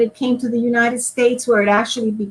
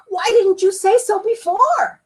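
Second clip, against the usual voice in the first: high, stunned question; exasperated surprise; 11 semitones higher than usual. Acted. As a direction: throw up your hands. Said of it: "exclaimed the carpenter".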